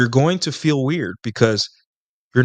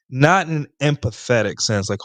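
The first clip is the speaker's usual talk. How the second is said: ordinary talk, nothing marked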